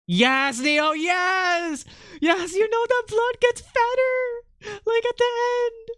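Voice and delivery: very high, drawn-out delighted cheering; excited squeal. Real, not acted. Cheering on the collaborator's drawing.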